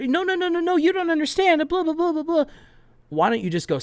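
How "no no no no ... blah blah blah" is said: very high-pitched mocking protest; mock defensive. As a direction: imitate a flustered, defensive objector in a squeaky high voice. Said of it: "being defensive and trying to talk".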